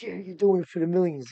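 mocking heckler. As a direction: sneer it out like the jeering guy in the crowd. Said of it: "the guy says".

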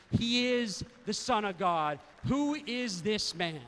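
preaching climax. Acted. Preaching at full force.

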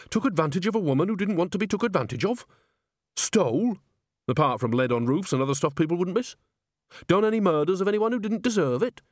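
put-on high-pitched, chatty old woman interrogating; Nanny questioning. A narrator's reading. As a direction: run through the crimes matter-of-factly.

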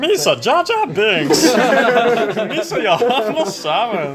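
high, slow Gungan alien voice; laughing through a squeaky alien voice; Jar Jar voice. Pretend. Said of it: "your Amy impression's getting really good".

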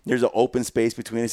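ordinary talk, nothing marked

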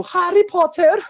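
"Harry Potter" pitched high and loud, rising at the end; silly name pronunciation. Acted. Quizmaster announcing the round, "Harry Potter" said high and silly. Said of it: "I don't know why we're calling him like this".

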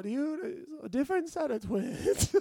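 high, slow, flustered excuse; boy lies to his friend, high and flustered; nervous backpedal. Retold or imitated. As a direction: squeak out a panicky cover story. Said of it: "and I go".

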